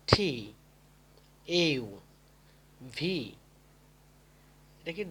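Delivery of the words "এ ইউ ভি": ordinary delivery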